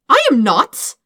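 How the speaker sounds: loud, high protest; indignant denial. Acted. Dirk protesting he isn't in love with Todd, loud. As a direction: blurt the denial hard.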